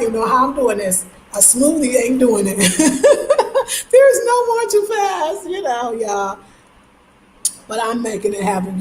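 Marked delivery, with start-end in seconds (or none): 2.6-3.8 high laugh. Real, not acted. Cracks up at own smoothie remark. 3.9-6.4 high, slow words through laughter; laughing. Real, not acted. Still laughing at own remark.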